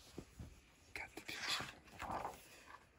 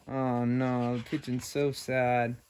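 ordinary delivery

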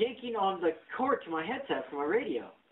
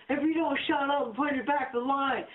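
imitated yelling; 7 semitones higher than usual. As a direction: imitate a line worker yelling at people. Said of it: "he's yelling at people".

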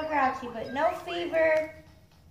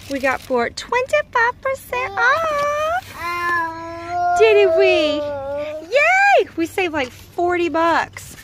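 high, loud and thrilled; excited; 5 semitones higher, 9 dB louder than usual. Real, not acted. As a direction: gush about the great deal. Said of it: "Very excited about this".

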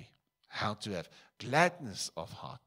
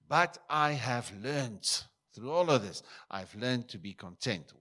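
emphatic climax; 4 semitones higher, 7 dB louder than usual. Acted. Paul's climax, preached emphatically.

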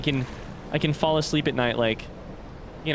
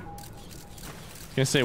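ordinary talk

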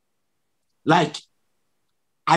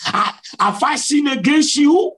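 high, raised cry to God; retold shout; 9 semitones higher than usual. Frightened believer asks Jesus if he has sinned, retold shout. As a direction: call out a pleading question at high pitch. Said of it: "I shouted and said".

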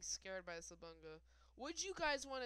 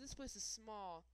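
ordinary delivery

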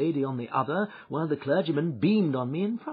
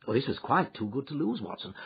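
plain reading voice

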